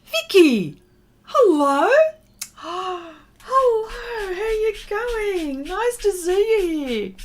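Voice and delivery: high, loud squeal of hello; gasping with joy at a friend joining; delighted greeting. Real, not acted.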